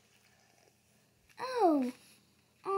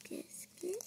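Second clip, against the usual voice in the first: normal talking voice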